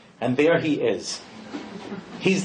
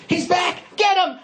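mob shout; 13 semitones higher than usual. Retold or imitated. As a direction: whip the crowd into chasing him. Mob sees wagon again.

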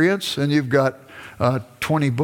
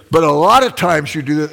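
louder retold comeback to a partner; insistent accusation; 3 semitones higher, 6 dB louder than usual. Husband backtracks into accusing his wife, insistent. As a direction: press the accusation, raised voice.

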